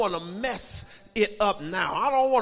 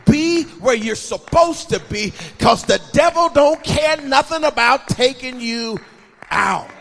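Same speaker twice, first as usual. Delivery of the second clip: loud, raised, hammering exhortation; full-force preaching; 5 semitones higher, 11 dB louder than usual. Real, not acted. Preacher warns teenagers and grown adults, full-force preaching. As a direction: drive each command out at full voice.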